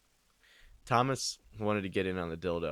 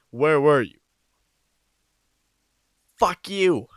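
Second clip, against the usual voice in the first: yelled; 7 semitones higher, 11 dB louder than usual. Pretend. Yelled argument.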